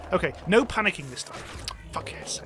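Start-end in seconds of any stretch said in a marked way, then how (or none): 1.9-2.5 breathy under-breath swear; whispered curse. Real, not acted. Gamer whispering a curse after failing. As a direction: hiss it quietly in frustration.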